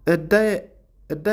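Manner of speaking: "Diet" lifted high and broken off before the t; cut-off Diet. Shown as a demonstration. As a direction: stop short at the end of "Diet". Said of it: "ይ ቁርፅ ተብላ".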